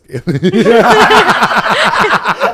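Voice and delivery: long, high, loud cackle; loud laughing fit. Real, not acted. Host confessing gross asparagus story, helpless high laughter. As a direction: lose it laughing at your own confession.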